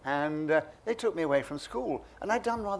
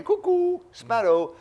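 high, slow sing-song clown call; clown's call; 7 dB louder, much slower than usual. Retold or imitated. Clown calling to his partner in the ring, high sing-song. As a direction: mimic a circus clown calling out. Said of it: "I started doing this with my brother".

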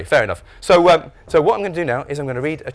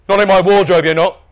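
loud, raised indignant retort; scolding dad; 5 semitones higher, 9 dB louder than usual. A narrator's reading. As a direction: firmly forbid it at high volume. Dad scolds his son.

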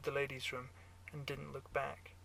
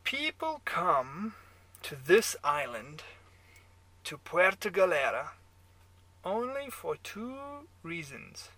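put-on slow, high, loud German-accented pronouncement; 4 semitones higher, 7 dB louder, slower than usual. A narrator's reading. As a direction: lecture him deliberately in a German accent. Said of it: "your German accent".

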